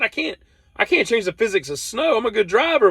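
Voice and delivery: high, indignant protest. Retold or imitated. Defending himself to roommate, high indignant squeak. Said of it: "I said".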